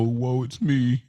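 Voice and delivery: usual speaking voice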